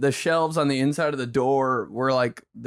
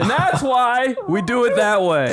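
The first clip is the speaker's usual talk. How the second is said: smug dad; 8 semitones higher than usual. Retold or imitated. Imitating dad finishing his point smugly. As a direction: lecture like a self-satisfied father. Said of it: "So he'd be like".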